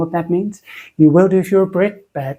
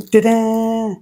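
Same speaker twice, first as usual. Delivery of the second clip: higher, long drawn-out "ta-da"; playful reveal; 6 semitones higher than usual. Pretend. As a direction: draw out the big reveal. Reveals the packaged soap.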